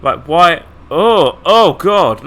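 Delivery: loud startled outcry. Real, not acted. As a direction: recoil in disgust, out loud. Creepy child appears.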